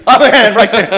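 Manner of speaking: chuckling through the words; laughing. Real, not acted. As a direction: laugh as you speak. Laughing at his own joke.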